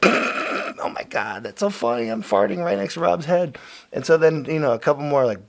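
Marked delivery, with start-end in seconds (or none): 0.0-3.6 put-on giggly drunk. Quoting himself drunk giggling.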